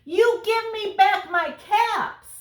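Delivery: high, loud, slow demand; a hammed-up finger-wagging telling-off; scolding peddler. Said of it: "shaking a finger at them".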